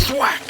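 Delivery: high punchy sound word; thwack. A narrator's reading. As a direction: smack it out. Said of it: "sound word".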